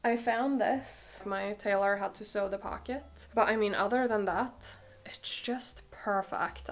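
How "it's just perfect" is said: unvoiced, slow hushed praise; whisper. Real, not acted. Whispering praise of a tailored blazer to viewers. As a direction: breathe it out like a secret. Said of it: "I feel like I have to whisper".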